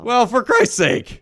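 high, loud, slow groan of frustration; exasperated outburst. Acted. Moviegoer hears tickets are sold out, high and exasperated. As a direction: throw up your hands.

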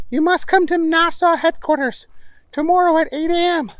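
high, bossy scientist voice; high professor voice. Acted. Stinkwell voiced in story.

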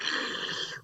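loud noisy slurp of mango pulp; long wordless sucking noise. Shown as a demonstration. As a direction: suck the juice out noisily.

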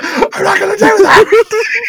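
high, loud, slow shout through laughter; laughing shout. Retold or imitated. Co-host yelling his refusal at a caller, laughing. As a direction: yell the refusal. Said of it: "He's like".

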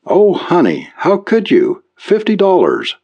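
a mother near tears over lost money; crying mother. A narrator's reading. Mother pleading with her son, tearful. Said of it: "she was crying".

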